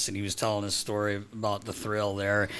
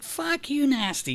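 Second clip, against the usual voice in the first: much higher jokey insult voice; mock chirp; slower than usual. Broadcaster retelling a teammate's playful insult. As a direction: toss it like a teasing jab.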